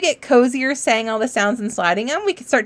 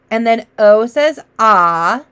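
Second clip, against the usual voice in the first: usual speaking voice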